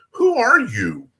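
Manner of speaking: high, baffled "who are you"; stunned partner. Retold or imitated. Partner stunned at the officer's accent switch, high and baffled. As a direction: ask as if he's never met you. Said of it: "he looks at me and he goes".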